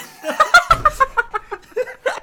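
loud, high cackle; loud put-on cackle; hammy laugh. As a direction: cackle wildly after the line. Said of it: "这是中国的笑声吗？".

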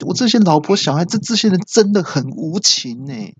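high, exaggerated whining complaint on the phone; whiny complaining dad. Caller whining to the phone system about his family, high and aggrieved. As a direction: pile up the grievances in a high wailing voice.

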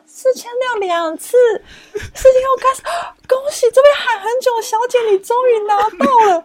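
imitating a loud auctioneer closing the sale; auctioneer announcing. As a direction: announce the winner like a game-show host. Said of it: "主持人讲".

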